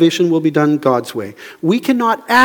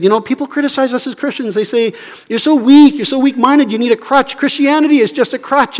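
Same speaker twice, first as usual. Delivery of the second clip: higher-pitched, quick retelling; 8 semitones higher than usual. Retold or imitated. Singer quoting critics of Christians, lightly mocking. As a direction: lightly mimic the critics' taunt. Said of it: "he, he said".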